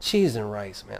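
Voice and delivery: low, subdued mutter, trailing off; apologetic. Acted. Podcaster mimicking someone using a substitute curse, apologetic. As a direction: say CHEESE AND RICE low and sheepish. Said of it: "you say it just like".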